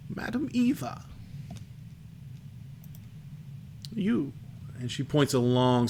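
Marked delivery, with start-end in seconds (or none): 0.1-4.3 high, hushed fortune-teller crone; crackly old crone. Acted. Fortune teller introduces herself and picks someone, crackly crone. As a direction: announce yourself in a dry, crackly high voice. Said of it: "her voice crackles".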